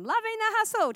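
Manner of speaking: high-pitched, hammed-up enthusiasm; mock delight. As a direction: lift it high as if thrilled. Contrasting past delight with later misery.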